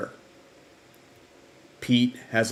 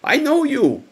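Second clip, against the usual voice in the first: imitated British accent, pitched way up high; high, lilting British impression of a woman recognising someone; Sally, British accent; 13 semitones higher than usual. British sister recognising Greg, imitated high British accent. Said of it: "in really deep British accent".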